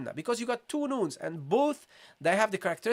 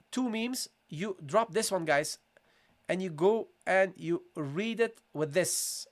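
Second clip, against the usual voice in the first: ordinary talk, nothing marked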